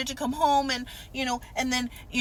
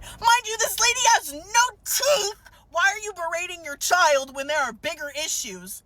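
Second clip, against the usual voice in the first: outraged rant; 9 semitones higher than usual. Real, not acted. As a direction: vent the outrage at the mother, voice cracking. Outraged rant about body shaming.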